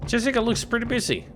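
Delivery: normal talking voice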